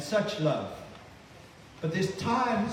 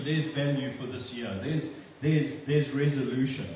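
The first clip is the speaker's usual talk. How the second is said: ordinary talk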